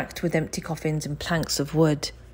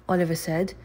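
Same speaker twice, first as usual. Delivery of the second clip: ordinary delivery, nothing marked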